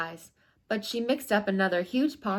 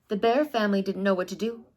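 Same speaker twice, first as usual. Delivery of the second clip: ordinary delivery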